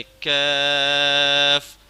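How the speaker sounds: کاف thin and light, drawn slow; thin kaaf. Shown as a demonstration. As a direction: keep کاف light at the front. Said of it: "اس کو باریک کر کے پڑھنا ہے".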